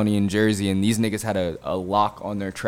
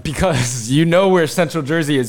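higher and louder, insisting; raised, indignant insisting; heated accusation; 7 semitones higher, 5 dB louder than usual. Real, not acted. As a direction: call him out, worked up.

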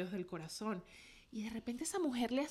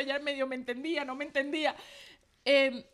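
ordinary talk, nothing marked

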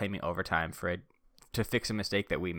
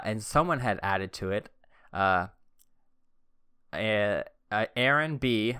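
normal talking voice

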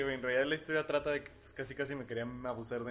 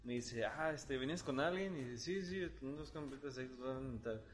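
put-on drunk mumble; 7 dB quieter than usual. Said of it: "así hablaba".

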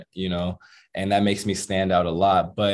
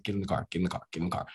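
hushed urgency; 2 semitones lower, 8 dB quieter, much faster than usual. Retold or imitated. Man telling his girl to flee the robber, hushed urgency. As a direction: urge her along under your breath. Retelling urgent order to girlfriend.

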